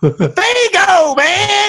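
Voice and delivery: high, stretched-out cry; hyped-up holler. Real, not acted. Fan telling baffled friend the soda, hyped-up holler.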